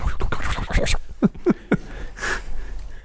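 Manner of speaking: breathy whispered giggle; doughboy giggle. Acted. Doughboy bell sound.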